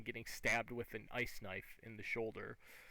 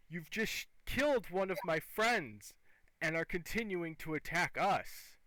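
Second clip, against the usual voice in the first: higher, louder, aggrieved creature voice; enraged hobgoblin captain; 7 semitones higher, 6 dB louder than usual. Acted. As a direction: accuse them as an outraged hobgoblin. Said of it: "in his fit of rage".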